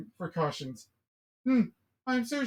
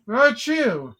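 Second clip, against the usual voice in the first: fake sneeze; 5 semitones higher, 8 dB louder than usual. Pretends to sneeze.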